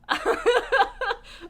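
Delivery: loud, high burst of laughter; big high cackle of laughter. Real, not acted. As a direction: crack up loudly. Laughing about library returns.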